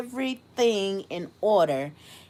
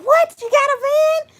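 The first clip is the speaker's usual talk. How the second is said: high, loud disbelieving question; astonished reaction; 8 dB louder than usual. Retold or imitated. Imitating the nurse hearing the van news, astonished. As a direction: squeal it as an amazed onlooker.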